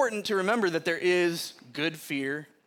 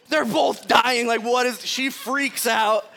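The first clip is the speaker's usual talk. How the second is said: ordinary delivery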